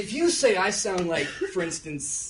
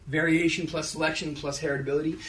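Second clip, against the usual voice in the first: ordinary talk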